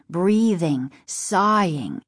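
ordinary delivery

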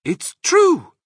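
very high, loud little voices; small foxes crying out. A narrator's reading. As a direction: pipe up loudly like little cubs. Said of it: "cried the small foxes".

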